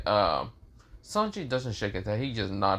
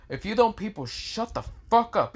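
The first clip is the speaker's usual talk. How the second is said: high-pitched irritated outburst; annoyed threat; 11 semitones higher than usual. Retold or imitated. Napper to gossiping classmates, high annoyed threat. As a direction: snap it high, as a scary warning. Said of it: "gets annoyed with such an tone".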